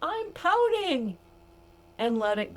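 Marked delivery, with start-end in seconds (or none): 0.0-1.2 very high, slow, delighted exclamation; laughing at yourself for pouting, very high; mock amused realization. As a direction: squeal it at yourself, then drag out pouting. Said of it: "laugh at yourself and go".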